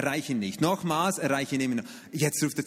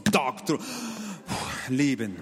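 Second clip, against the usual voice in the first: ordinary talk, nothing marked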